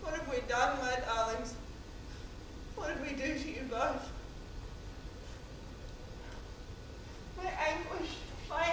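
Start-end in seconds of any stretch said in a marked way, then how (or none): 0.0-4.1 low, soft grieving questions; sorrowful lament. Acted. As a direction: sink into quiet remorse over your children. Dead mother grieves over sons.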